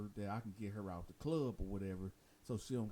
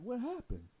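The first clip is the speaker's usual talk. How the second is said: alarmed question; 12 semitones higher, 5 dB louder than usual. Retold or imitated. Asking the inmate what happened.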